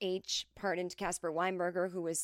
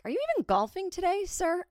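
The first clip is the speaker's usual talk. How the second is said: high, quick sarcastic service voice; waitress teasing a drunk club member; mock polite waitress; 10 semitones higher than usual. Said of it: "you're like".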